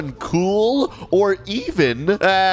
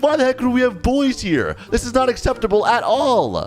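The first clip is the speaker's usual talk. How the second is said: high-pitched mock indignation; mad girl; 7 semitones higher than usual. Girl objecting to boys in her school, mad. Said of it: "this girl's mad".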